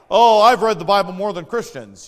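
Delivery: loud, sneering know-it-all atheist voice; mock the boastful skeptic, loudly; mocking smug atheist. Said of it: "You hear these atheists talk like that".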